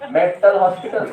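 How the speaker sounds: mock loud announcement, laughing; loud retold answer. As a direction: blurt it out loud through a laugh. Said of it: "इतना जोर से बोलो".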